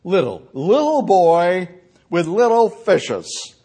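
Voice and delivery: "Little" slowed and leaned on each time; preacher dwelling on "little" in "Little boy with little fishes"; stress on little. Shown as a demonstration. Preacher stressing "little" to the congregation, slow and deliberate. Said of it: "That's the emphasis".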